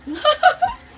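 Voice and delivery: high, loud cackle; real high-pitched laugh; burst of laughter. Real, not acted. Laughs at her own look.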